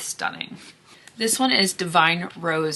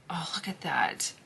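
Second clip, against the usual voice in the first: whisper; 11 dB quieter than usual. Real, not acted. Admiring shimmer shades.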